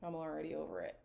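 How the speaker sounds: very soft, low and deflated; fed-up aside. Real, not acted. Reacting to a contestant's quote, quietly fed up. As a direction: mutter it low, already done with him.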